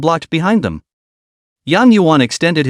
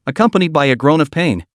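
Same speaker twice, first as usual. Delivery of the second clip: ordinary delivery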